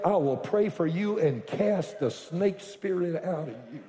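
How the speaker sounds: usual speaking voice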